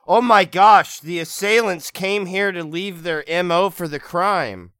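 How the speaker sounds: loud, high, breathless alarm; excited gasp. Acted. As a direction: gasp it out in shock. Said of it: "I say".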